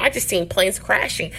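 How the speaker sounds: usual speaking voice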